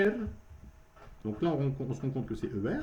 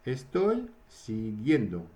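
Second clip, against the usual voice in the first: slow model phrase; slower than usual. Shown as a demonstration.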